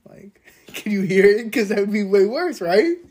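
laughing while talking; giggling through the question. Real, not acted.

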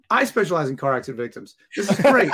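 echoing the doctor, rising into a big laugh; sarcastic cheer breaking into laughter; mock excited echo. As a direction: parrot his line with fake delight, then crack up. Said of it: "I'm like".

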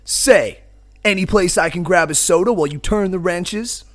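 loud, projected hero small talk; cocky biker hero. Acted. Biker asks mechanic about a soda, breezy. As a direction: ask it like an old movie drifter.

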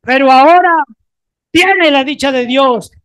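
loud and high, proclaiming to a neighbour; shouted declaration. Acted. Preacher shows what to tell the person beside you, shouting. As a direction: call it out with fervour. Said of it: "voltea de al lado y dile".

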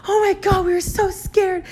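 high, whiny mock sobbing; imitated crying friend. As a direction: wail like a panicked, tearful friend. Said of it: "One of them is crying".

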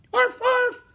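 very high, loud yapping arf arf; small dog barking. A narrator's reading. Teddy the dog barking happily, very high yaps. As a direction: yap like a little puppy. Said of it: "Teddy barked".

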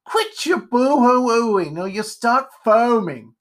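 high, loud, drawn-out scolding; irritated. Acted. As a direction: scold in a crotchety character voice.

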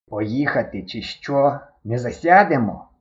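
slow, hesitant mumble; промимрити неквапливо, вагаючись; mumbled. Acted. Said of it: "промимрив". (повільно, невпевнено промимрив)

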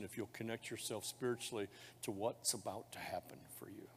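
hushed whispered promise. Real, not acted. Pastor to the baptism candidates, whispering. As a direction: lean in and whisper it.

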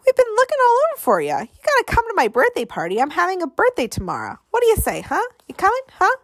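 put-on very high, rapid kid voice; excited boy pleading for a yes; blurting, eager. A narrator's reading. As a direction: gush out an invitation in a rush. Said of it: "blurted Piper".

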